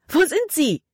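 high-pitched frightened outcry; panicked scream. A narrator's reading. Libby loses sight of boy and dog, panicked scream. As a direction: cry out in sudden panic. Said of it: "schrie Libby auf".

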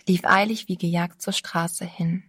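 ordinary delivery, nothing marked

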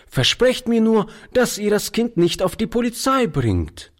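put-on high, urgent boy's plea; pleading, near tears. A narrator's reading. Boy begs old man to avoid police, pleading. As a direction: beg with a touch of tears. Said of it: "dabei kamen ihm die Tränen".

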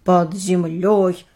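slow eerie whisper-like tone; ominous, drawn out; creepy voice. Acted. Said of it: "жутким голосом произнесла Элли".

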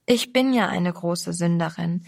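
ordinary delivery, nothing marked

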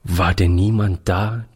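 ordinary delivery

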